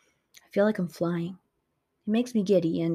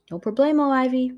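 cheery no problemo; 6 semitones higher, 6 dB louder than usual. Acted. Kiji cheerfully answering the narrator.

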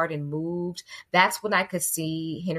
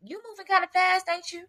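sassy call-out; 11 semitones higher, faster than usual. Retold or imitated. Mock scolding the other mother.

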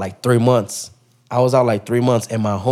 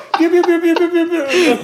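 rapid, very high repeated chirping; high cartoon noise. Friends joke he was like a cartoon, high silly chirping. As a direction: imitate cartoon birds circling a dazed head.

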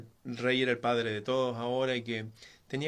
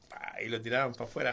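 ordinary delivery, nothing marked

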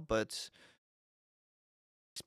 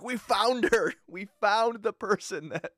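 excited producers; 8 semitones higher, 5 dB louder, slower than usual. Retold or imitated. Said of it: "They're like".

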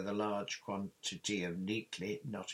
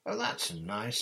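higher, louder offended retort; huffy; 5 semitones higher, 5 dB louder, slower than usual. A narrator's reading. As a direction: take offence at being sent away. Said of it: "said Ginny huffily".

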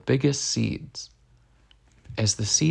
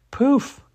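magic poof; 14 semitones higher, 6 dB louder than usual. A narrator's reading. Magic bus grows big.

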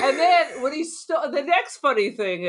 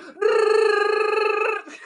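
high laugh; 11 semitones higher than usual. Real, not acted. Laughs at own joke.

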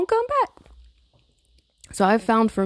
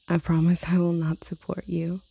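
whisper. Real, not acted.

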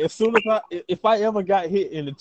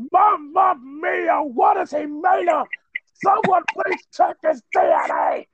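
frantic high commentator shout; hammed-up, hoarse-whispered shouting of an awestruck announcer; Mauro Ranallo; 9 semitones higher, 4 dB louder than usual. Wrestling announcer calling an unbelievable match, frantic shout. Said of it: "can you imagine Ranallo calling a P C O match".

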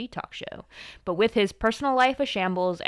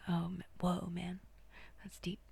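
very soft, mock-profound murmur; hushed imitation of being awed; mock awe; 14 dB quieter than usual. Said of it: "that's how I went. I was like".

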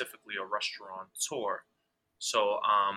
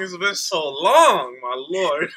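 laughing; 8 semitones higher, 14 dB louder, slower than usual. Real, not acted. Laughing disbelief continues.

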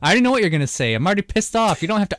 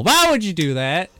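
normal talking voice

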